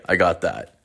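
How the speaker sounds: ordinary delivery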